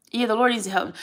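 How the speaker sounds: ordinary talk, nothing marked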